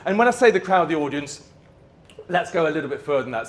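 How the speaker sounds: ordinary talk, nothing marked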